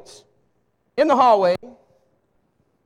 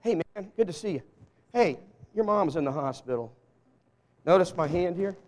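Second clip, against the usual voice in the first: softer, lower, caring question; sincere concern; 4 semitones lower, 3 dB quieter than usual. Shown as a demonstration. Preacher showing how he asks when he truly cares, soft and sincere. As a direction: lean in and really ask. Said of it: "let me tell you the difference".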